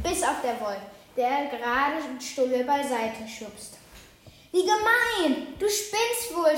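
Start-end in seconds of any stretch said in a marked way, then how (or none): 4.5-6.6 higher, indignant little raven telling off; scolding raven. A narrator's reading. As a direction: scold the pushy wolf. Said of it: "schimpft der kleine Rabe". (empörtes Schimpfen)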